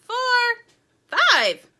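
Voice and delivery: high, loud final numbers; loud count-off. A narrator's reading. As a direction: call out each number forcefully. Count rising to a surprise fifth.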